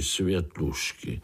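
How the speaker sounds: ordinary delivery, nothing marked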